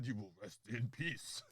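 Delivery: low, slow, quiet doom voice; mock ominous sign-off. Fan mimicking a wrestler's catchphrase, low slow menace. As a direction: intone it softly and darkly.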